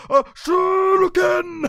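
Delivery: drawn-out, high, loud battle cry; stretched-out arcade attack shout; Street Fighter move shout. Retold or imitated. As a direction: yell it like a fighting-game special move. Street Fighter fans out there.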